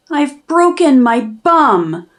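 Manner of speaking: ordinary delivery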